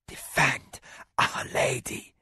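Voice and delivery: weak croaking whisper; croaked. A narrator's reading. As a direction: croak it out, dying. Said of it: "croaked a voice".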